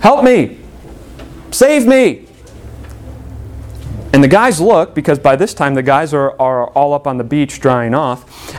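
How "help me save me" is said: higher, panicked call for help; a retold drowning man's high plea for rescue; mock cry for help. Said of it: "he starts screaming".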